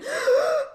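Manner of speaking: high laughing gasp; mock sobbing gasp. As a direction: gasp like a crying mess. Imita el grito ahogado al voltear. (jadeo agudo entre risa y llanto)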